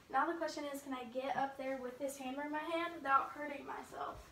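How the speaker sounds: ordinary talk, nothing marked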